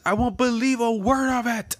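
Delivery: high, loud flat refusal; Ivankov voice. Acted. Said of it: "He says".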